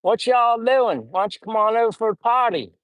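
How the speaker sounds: impersonated Southern host; Southern accent. Acted. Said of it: "too much of a Southern accent".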